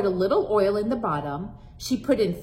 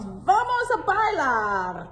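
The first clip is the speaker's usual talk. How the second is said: playful invitation; 8 semitones higher, slower than usual. Acted. Abuela invites dancing.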